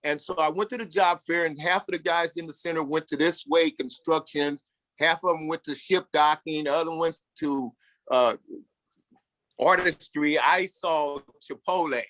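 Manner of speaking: ordinary talk, nothing marked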